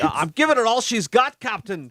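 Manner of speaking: a hint of Scotty's Scottish brogue; light Star Trek engineer accent; lightly Scottish Scotty line; Scotty impression. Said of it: "it sounds like Scotty from".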